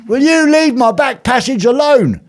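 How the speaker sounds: high, loud, slow indignant protest; mock outrage. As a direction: ham up the offended complaint.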